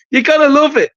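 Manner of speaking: ordinary talk, nothing marked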